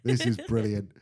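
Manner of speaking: delighted laughing reply. Retold or imitated. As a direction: gush through laughter. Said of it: "I was like".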